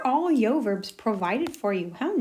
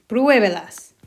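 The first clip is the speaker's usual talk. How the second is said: Pruébelas, louder, first syllable PRUÉ stressed; 8 dB louder, slower than usual. Shown as a demonstration. Teacher showing stress to students, PRUÉ emphasized. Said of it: "put an accent on that first syllable".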